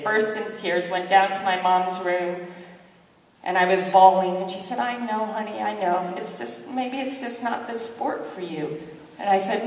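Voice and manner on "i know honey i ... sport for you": soft, hushed consolation; comforting mom. Retold or imitated. Mom consoles her crying daughter in a soft, hushed voice. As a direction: gently let her down easy.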